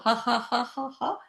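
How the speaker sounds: short, high ha-ha beats; deliberate staccato laugh; laughter. Shown as a demonstration. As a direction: laugh out each pretend action. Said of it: "with a sense of fun and laughter".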